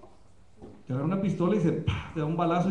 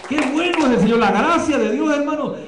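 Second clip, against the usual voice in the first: preaching at full force; 5 dB louder than usual. Acted. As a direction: lift the congregation with the cry of grace. (predicando con toda la fuerza)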